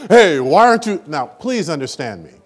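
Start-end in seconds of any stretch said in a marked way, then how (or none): 0.1-1.0 high, loud scolding lawgiver voice; a preacher mocking the scolding lawgiver. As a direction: play the nagging lawgiver, hammed up. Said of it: "Do we go as a lawgiver?".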